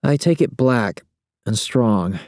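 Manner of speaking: slow, slightly irritated woman; a touch of annoyance, unhurried; mild annoyance. Acted. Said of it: "Mild annoyance".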